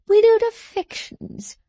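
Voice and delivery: high, scornful outburst; sneering at his rival's words; ineffable contempt. Acted. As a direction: spit it out with contempt. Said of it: "with an ineffable contempt".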